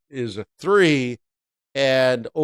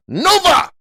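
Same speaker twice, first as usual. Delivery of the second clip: very high, loud cry; loud mock verdict; 7 dB louder than usual. Trader vetoing the trade in foreign words, loud mock verdict. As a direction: belt it out high and hard.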